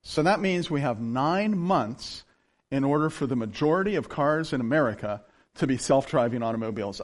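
ordinary talk, nothing marked

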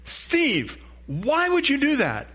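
a high exasperated reprimand to himself; self-scolding. Retold or imitated. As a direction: scold yourself in disbelief. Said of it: "you say".

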